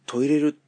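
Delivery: トイレる set apart as a Kansai dialect word; Kansai word shown. Shown as a demonstration. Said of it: "洗濯物トイレるとかね". (関西弁として「トイレる」と言う)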